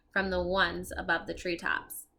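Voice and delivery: ordinary delivery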